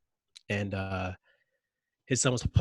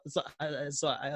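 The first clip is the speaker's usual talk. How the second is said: ordinary talk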